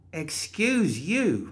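high, slow offended drawl; slow, indignant high voice; huffy. Acted. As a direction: drag it out, offended. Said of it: "Molly huffed".

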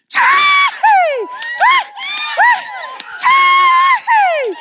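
high, loud celebratory shouts; joyful shout. Shown as a demonstration. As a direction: call it out like a joyful crowd. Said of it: "this is what we call vela mafana".

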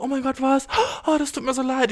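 viel höher, leicht gespielte Bestürzung; Partner entschuldigt sich. Pretend. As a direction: den zerknirschten Partner mimen. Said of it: "würde mein Partner im besten Fall sagen so". (Partner, höher und erschrocken entschuldigend)

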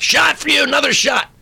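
high-pitched mimicked call; pushy waitress. Another waitress bringing the comic a shot, high mock call.

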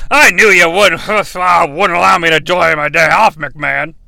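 put-on slurred drunken complaint; drunk wrestler. Wrestler griping to McMahon over his day off, drunken slur. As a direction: moan it with a boozy slur. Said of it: "He's under the influence".

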